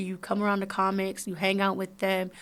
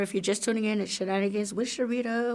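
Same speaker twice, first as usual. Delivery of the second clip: normal talking voice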